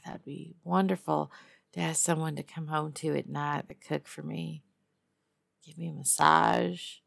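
normal talking voice

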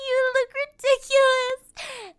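loud, high, slow jeering; mocking shout. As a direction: howl it out in mockery. Mocking the costumed victim.

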